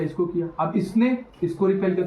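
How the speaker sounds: ordinary talk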